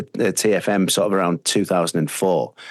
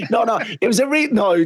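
laughing while protesting, higher than usual; 13 semitones higher than usual. Real, not acted. DJ laughs off the salary joke with the host, laughing. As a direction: giggle through the denial.

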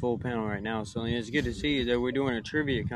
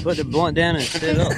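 loud telling-off; 5 semitones higher, 9 dB louder than usual. Real, not acted. Scolding friend on call.

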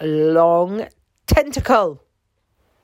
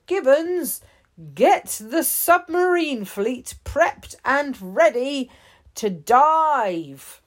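raised, firm chief's command; chief giving orders; 7 semitones higher than usual. A narrator's reading. Chief orders Gibbons to prep the submarines, firm and raised.

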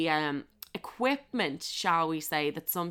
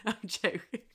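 laughing. Real, not acted. Laughing at her own joke.

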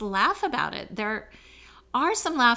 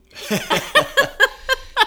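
high burst of real laughter; big laugh; 11 dB louder than usual. Real, not acted. Laughs off fear of crying.

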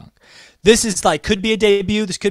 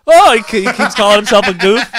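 laughing; 6 semitones higher, 9 dB louder than usual. Real, not acted. Laughing at the goof bit.

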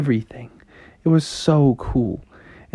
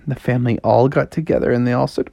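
ordinary delivery, nothing marked

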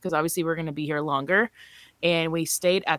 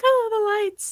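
awed gasp. Retold or imitated. Gasping at the bright lights.

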